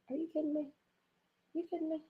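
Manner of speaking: muttered disbelief. Retold or imitated. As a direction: act out someone incredulous under their breath. Acting out Vaughn's muttered reaction.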